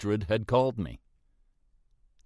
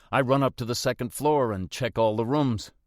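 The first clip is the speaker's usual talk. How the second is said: ordinary delivery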